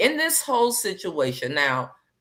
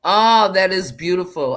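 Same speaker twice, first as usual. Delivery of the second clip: louder, delighted cry over a pretty name; 7 dB louder than usual. Real, not acted. Admiring a viewer's name.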